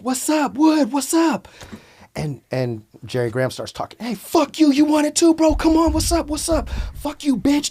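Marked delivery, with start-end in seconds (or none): 0.0-1.5 high shout down the hall; calling out. Retold or imitated. 4.0-7.7 aggressive taunt. Retold or imitated. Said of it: "disrespecting me, talking hella shit".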